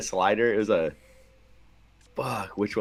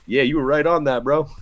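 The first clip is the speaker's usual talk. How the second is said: higher, hammed-up teammate voice; mock dugout praise; 6 semitones higher, 4 dB louder than usual. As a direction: fake encouragement in the dugout. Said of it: "someone's like".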